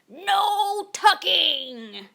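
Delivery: slow chanted crew cry. A narrator's reading. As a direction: drag it out as the whole crew. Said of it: "the crew cried".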